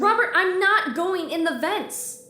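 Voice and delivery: loud, high protest; snapping back at Robert over the vents, loud and high; angry refusal. Acted. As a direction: shout him down, outraged. Tiff refuses to enter vents.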